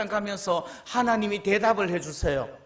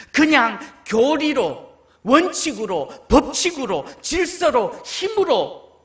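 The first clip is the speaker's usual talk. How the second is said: loud emphatic repetition on 하나님; preaching; 3 semitones higher, 5 dB louder, slower than usual. Real, not acted. Preacher exhorting the congregation, loud. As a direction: build the list with force.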